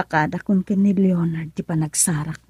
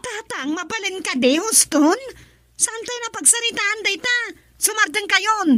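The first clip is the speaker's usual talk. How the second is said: upset pleading; 8 semitones higher than usual. Acted. Daughter pleading with father.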